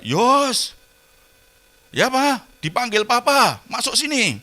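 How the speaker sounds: imitating his wife calling the boy in. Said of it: "Dari kamar langsung teriak".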